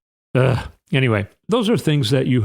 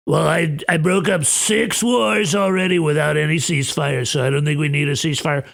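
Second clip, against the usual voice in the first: a light mock Trump voice, a bit higher and brisk at the end; Trump impression; 3 semitones higher than usual. Trump waving off the European leaders, lightly mocked. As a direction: brush them off like a smug Trump.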